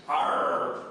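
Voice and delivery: growly pirate "Arr" with drawn-out words; slow joke-pirate punchline. Acted. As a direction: play a pirate, dragging it out. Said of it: "The pirate says".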